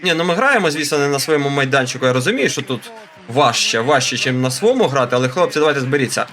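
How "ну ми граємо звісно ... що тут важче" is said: normal talking voice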